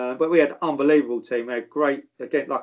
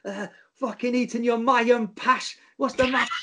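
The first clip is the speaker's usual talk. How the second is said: higher, slow put-on voice of a raging manager; mock manager insult; 8 semitones higher than usual. Manager abusing a cockney player, higher and slow. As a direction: sneer it out like the mad old manager. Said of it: "And then he'd go, like".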